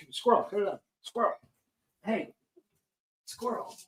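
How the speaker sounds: mock calling out to a friend, voice climbing. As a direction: call him over, pitch rising, fading to a hushed hey. Calling friend over at festival.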